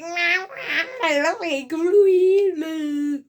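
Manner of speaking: high, drawn-out goofy wail; silly nonsense knight. Retold or imitated. Reviewer imitating the knights' new word, high goofy mock wail. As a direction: garble it loudly as a silly knight.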